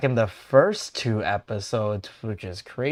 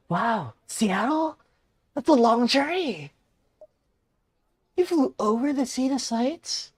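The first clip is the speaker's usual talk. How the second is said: high, impressed host, louder; older man character; 14 semitones higher, 4 dB louder than usual. Acted. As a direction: play an amazed older host in a raised pitch. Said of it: "he is old".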